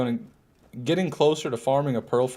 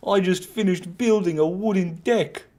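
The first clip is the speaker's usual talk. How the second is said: higher, laughing mock New Zealand accent; 7 semitones higher than usual. Shown as a demonstration. Streamer tests his New Zealand accent for chat, mock New Zealand accent. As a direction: try the deck line as a New Zealander. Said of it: "How's that?".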